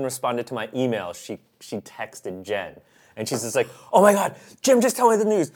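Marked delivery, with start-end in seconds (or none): 3.9-5.5 higher, louder, breathless imitation; mock frantic excitement. Fiancée texting her best friend, mock frantic and higher. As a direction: impersonate a frantic girlfriend.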